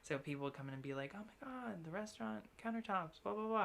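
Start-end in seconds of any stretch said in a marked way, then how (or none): 1.1-3.6 mock amazed customer. Customers recognizing the old countertops, mock amazed. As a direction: lightly parody a starstruck visitor.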